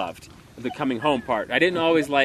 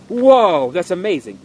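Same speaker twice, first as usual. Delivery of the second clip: loud, high burst of acted amazement; awed excitement; 4 semitones higher, 8 dB louder, slower than usual. Preacher acting out a reader opening the Word of God, awed excitement. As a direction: exclaim as if suddenly amazed, opening on a big whoa. Acted reaction to finding amazing verse.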